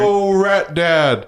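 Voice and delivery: yelling through a little cone megaphone. Pretend. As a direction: call out slow through a paper cone. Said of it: "It sounds like that 'cause I'm yelling through the, the cone".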